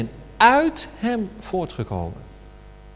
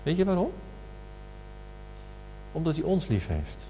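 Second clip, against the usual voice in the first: ordinary talk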